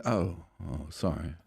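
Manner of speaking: a leader backing down after a blunder, mild sheepish sorry. Retold or imitated. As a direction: shrink into a small apology. Truss apologising sheepishly.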